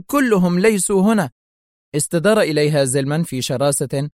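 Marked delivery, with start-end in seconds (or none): 0.1-1.3 higher, urgent exclamation; crying out. A narrator's reading. Secretary cuts in to help the principal, exclaiming. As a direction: call it out to back her up. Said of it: "هتفت السكرتيرة".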